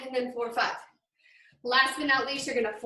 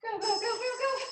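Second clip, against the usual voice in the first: cheering; 10 semitones higher, 4 dB quieter than usual. Real, not acted. Cheering on the final push.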